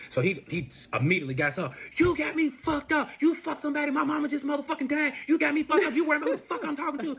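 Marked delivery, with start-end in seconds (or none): 1.9-7.1 mock angry boyfriend. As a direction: ham up his furious rant, pitched high. Boyfriend voiced yelling at her.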